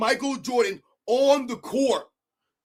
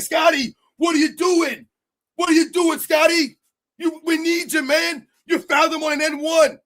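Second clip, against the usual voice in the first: loud, higher frustrated yell at Scottie; mock screaming at teammate; 4 semitones higher, 3 dB louder than usual. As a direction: imitate a coach-like blowup at a teammate. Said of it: "start screaming, yelling, and be like".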